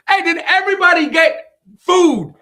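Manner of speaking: loud, high call to a big crowd; yelling to a crowd. Acted. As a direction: holler it out to the back row. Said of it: "I think he had to yell".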